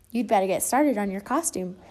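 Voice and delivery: ordinary delivery, nothing marked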